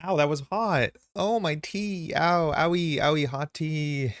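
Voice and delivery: high-pitched ows over a hot sip; pained yelps. Real, not acted. Streamer burned by hot tea, high pained whimpering. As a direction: wince aloud at scalding tea.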